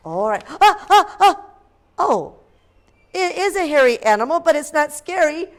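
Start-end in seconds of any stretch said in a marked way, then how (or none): 0.6-1.4 startled cry. A narrator's reading. Fright cry at the bed.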